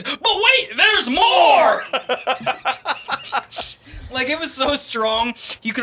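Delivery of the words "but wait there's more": loud infomercial shout; Billy Mays pitchman. Retold or imitated. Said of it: "It was like Billy Mays".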